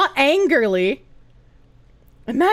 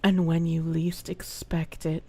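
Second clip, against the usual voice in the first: much lower, slow, soft menacing voice; movie villain threat; 10 semitones lower, 6 dB quieter, slower than usual. Pretend. Streamer playing a menacing villain toward a viewer. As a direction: threaten them like a film villain, low and slow.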